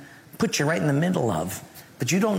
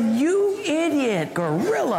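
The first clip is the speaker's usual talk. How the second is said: mock insult; 11 semitones higher, slower than usual. Angry retort to roommate flooding room.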